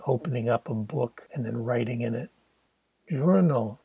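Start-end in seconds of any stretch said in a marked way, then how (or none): 3.1-3.8 journal said the French way, zhour-nal; North African French accent. Retold or imitated. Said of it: "he repeated in his North African French accent".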